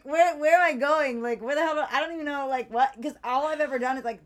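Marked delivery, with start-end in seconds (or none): 0.0-2.9 loud, high, flustered questions; panicked confusion. Retold or imitated. Stuck on the airport train, loud panicked questions. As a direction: fluster aloud, lost and panicking.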